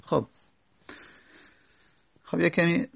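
ordinary talk, nothing marked

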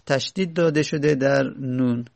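ordinary talk

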